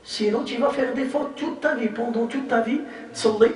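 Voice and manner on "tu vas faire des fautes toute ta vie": normal talking voice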